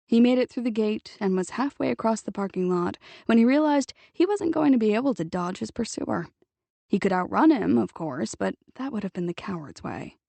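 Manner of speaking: plain reading voice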